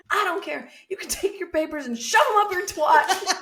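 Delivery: hammed-up furious witness; imitating her fury. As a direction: spit it at the lawyer. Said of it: "she said".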